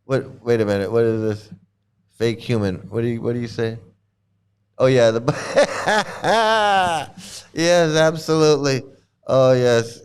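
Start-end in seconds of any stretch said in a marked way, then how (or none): none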